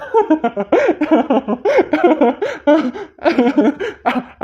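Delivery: a long, high burst of laughter that won't stop; long amused laugh. Acted. Uncle bursting out laughing at his niece's roundabout plea.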